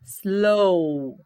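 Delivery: "Slow" held long on the O; drawn-out sound demo. Shown as a demonstration. Teacher having students hear the ending, long O. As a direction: let the O in "Slow" ring out, W silent. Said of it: "Ouçam".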